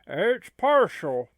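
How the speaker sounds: partial said slowly and leaned on; slow stressed partial. Acted. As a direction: hit partial, slow and firm. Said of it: "Yes, the word is partial".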